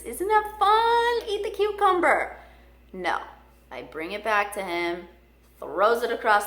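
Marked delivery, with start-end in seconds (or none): 0.0-2.4 coaxing pet talk. Retold or imitated. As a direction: sweet-talk the parrot into eating. Coaxing the parrot to eat.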